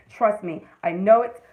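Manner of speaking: ordinary delivery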